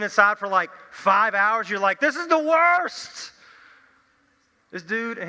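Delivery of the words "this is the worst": high, slow, drawn-out groan of boredom; hammed-up cabin-fever whine; mock-whiny complaint. Someone stuck indoors for hours complains, high and drawn out. Stuck inside on rainy day.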